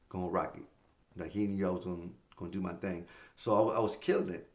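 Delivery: ordinary talk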